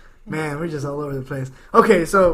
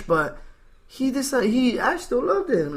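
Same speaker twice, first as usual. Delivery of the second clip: normal talking voice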